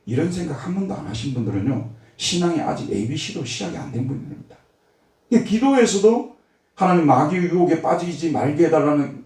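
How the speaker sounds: ordinary talk, nothing marked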